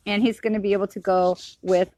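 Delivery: ordinary delivery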